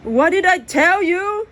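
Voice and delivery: loud angry scold. Acted. Dad scolding Jack on the phone, loud and angry. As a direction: scold him hard.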